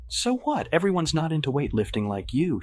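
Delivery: high-pitched, irritated retort; annoyed. A narrator's reading. Woman defending a man against her boyfriend, annoyed. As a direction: brush him off with shrill-edged annoyance. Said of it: "annoyed, looking back".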